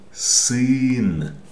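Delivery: sin drawn out with the hard Russian Y vowel; model pronunciation. Shown as a demonstration. Teacher asking learners to try the word, slow hard sin. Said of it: "try to say sin".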